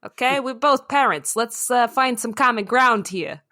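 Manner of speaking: New York raccoon calling a truce; New York accent. Acted. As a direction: talk the other parent down in a New York accent. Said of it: "The raccoon has a New York accent".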